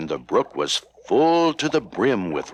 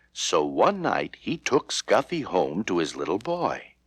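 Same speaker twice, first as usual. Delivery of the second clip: usual speaking voice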